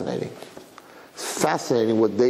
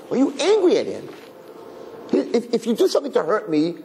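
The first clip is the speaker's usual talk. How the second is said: repeated higher, pressing on angry; higher rebuke; 10 semitones higher than usual. Real, not acted. Speaker rebuking angry parents, asking again, sharply higher. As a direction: press the question harder, pitch up.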